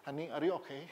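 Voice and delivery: very soft, understated attempt; awkward imitated tenderness. Stiff husband trying to copy tenderness, awkward and soft. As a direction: ask sweetly but hesitantly, not quite managing it. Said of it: "Meskipun nadanya belum bisa gitu".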